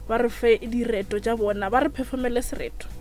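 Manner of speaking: usual speaking voice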